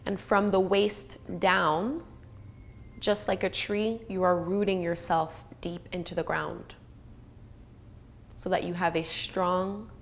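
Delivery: calm, unhurried meditation voice with long pauses; guided meditation. Acted. Teacher guiding students to soften and relax, meditative.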